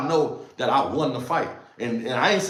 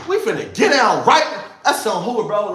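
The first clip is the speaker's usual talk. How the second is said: hyped up; 5 semitones higher, 6 dB louder than usual. Retold or imitated. Retelling hype before jail fight.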